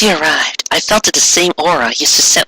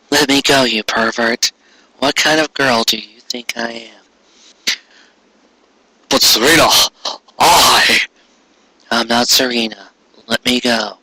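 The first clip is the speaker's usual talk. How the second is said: Sonia's voice; 4 semitones lower than usual. A narrator's reading. Sonia pushing Darian away, low and indignant. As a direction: push him off, low and hushed.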